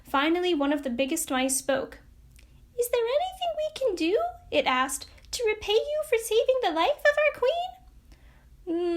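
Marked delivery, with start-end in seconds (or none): 2.8-9.0 eager mouse. Acted. Big mouse asking how to repay the woodman, eager. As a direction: offer help gratefully.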